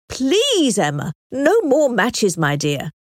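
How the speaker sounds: ordinary delivery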